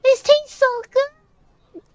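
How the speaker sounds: put-on loud, slow, very high child; drawn-out high-pitched delight; kid voice. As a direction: savour it like a delighted little kid. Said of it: "I'm reading in this kid voice".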